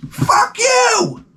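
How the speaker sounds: very high shriek of a furious wife. Retold or imitated. Wife screams back.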